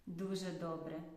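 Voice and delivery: ordinary talk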